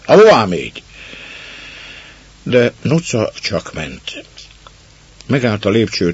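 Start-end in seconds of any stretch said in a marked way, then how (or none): 0.0-0.8 loud, higher stunned scolding; astonished growl. Acted. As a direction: bark at the intruder in disbelief. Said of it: "elképedve mordultak rá".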